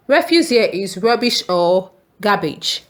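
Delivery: ordinary talk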